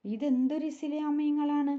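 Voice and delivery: put-on puzzled little girl's wonder; amazed. A narrator's reading. Kunjupathumma stares at Aisha, amazed. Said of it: "അമ്പരപ്പോടെ".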